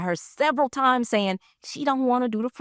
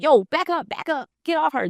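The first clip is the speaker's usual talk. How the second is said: retold shout; 7 semitones higher than usual. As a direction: bark it out like a bodyguard stepping in. Bodyguard pulling [name] off [name].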